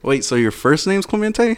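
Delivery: puzzled, high-rising double-check; surprised question. Real, not acted. Co-host just learning his friend's real first name, surprised. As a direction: pitch climbing high in disbelief.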